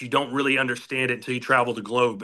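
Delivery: ordinary talk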